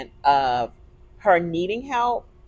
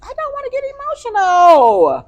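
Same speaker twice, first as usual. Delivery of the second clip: tearful and louder, voice high and breaking; pleading with herself through real tears; crying; 13 semitones higher, 5 dB louder than usual. Real, not acted. Cohost tells her to breathe, she answers through crying.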